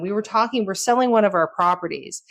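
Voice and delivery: ordinary delivery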